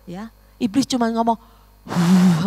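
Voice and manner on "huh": loud blowing "huh"; forceful exhaled whoosh; blown puff of air. Retold or imitated. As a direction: imitate the devil blowing one breath. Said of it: "hembusin satu tiupan ya".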